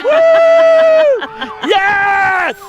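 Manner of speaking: high, loud excited cheering. Real, not acted. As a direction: cheer on your best friend. Cheering best friend's float.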